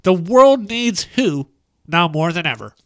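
put-on nerdy Bill Gates voice. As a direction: read it as a high-voiced nerd. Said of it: "still working on the nerdy voice".

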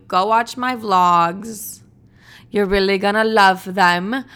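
slow, loud, drawn-out plea; urgent plea. Real, not acted. Host to her YouTube viewers, a slow loud plea. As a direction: make them go watch it now. Begging listeners to watch vlogs.

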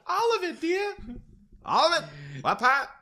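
very high falsetto prim lady; hammed-up falsetto Lemonade Lucy; mock prim Lucy. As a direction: squeak it like a prim first lady. Mock Lucy answering primly.

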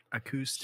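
"acoustic" with a coup sound; soft practice a-COO-stic; correct "acoustic". Shown as a demonstration. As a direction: quietly say "acoustic" as uh-KOO-stick. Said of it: "Like a coup d'etat".

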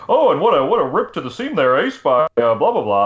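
lightly voiced hype announcer; brief mock play-by-play burst; cheesy commentator voice. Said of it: "Versus, like".

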